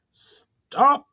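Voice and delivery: high, loud barked order; stern command. Acted. Brawl ordering the mages to stop, loud stern shout. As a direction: halt them all with one sharp command. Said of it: "carrying a note of stern command".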